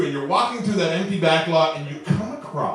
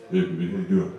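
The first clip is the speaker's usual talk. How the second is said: smooth pickup voice; 13 semitones lower, 4 dB quieter, much faster than usual. Acted. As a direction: drop deep and smooth to charm her. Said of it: "but I got this voice".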